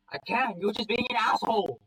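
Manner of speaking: high, loud defiant outburst; acted yell, pitch shooting up. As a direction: yell back at a scolding dad. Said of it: "I yell so loud my voice cracks".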